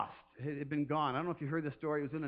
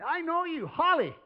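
higher, louder call of a name; 12 semitones higher, 7 dB louder than usual. Retold or imitated. God calling a listener by name, higher and louder. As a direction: call her like a shepherd. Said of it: "He goes".

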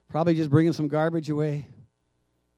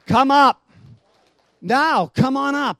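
loud, high call to come up; Jesus calling the faithful up; commanding call; 8 semitones higher, 10 dB louder, slower than usual. Acted. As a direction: shout it like a summons. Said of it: "it'll come with a command".

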